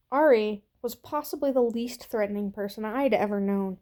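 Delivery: ordinary delivery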